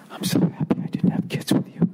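hushed breathy whisper, no voice; creepy whisper. Pretend. Parting shot whispered into the ex's ear: creepy whisper. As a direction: whisper it close to her ear, menacing. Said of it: "and then whisper in her ear".

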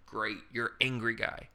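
usual speaking voice

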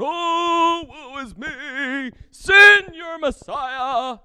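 hammed-up wailing, high and slow; mock lament rising to a loud cry; mock mourner. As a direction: wail like a showy Pharisee in sackcloth. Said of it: "putting on your ripped up garments and going".

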